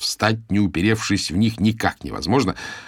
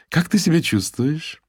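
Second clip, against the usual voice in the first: high chirpy voice; 10 semitones higher than usual. A narrator's reading. Chirpy colleague voiced by narrator.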